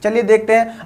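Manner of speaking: ordinary talk, nothing marked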